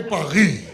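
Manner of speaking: Paris said paree with no s; French Paris. Shown as a demonstration. Telling the audience to say paree, French style. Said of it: "You must say".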